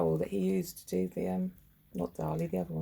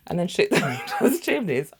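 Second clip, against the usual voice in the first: higher, louder, laughing through the words; 8 semitones higher, 7 dB louder than usual. Real, not acted. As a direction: crack up on the punchline.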